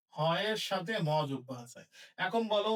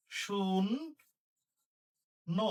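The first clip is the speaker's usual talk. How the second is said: শূন্য held long as "shunno"; drawn-out dictation; much slower than usual. Shown as a demonstration. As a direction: stretch শূন্য very slowly. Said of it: "শূন্য মানে".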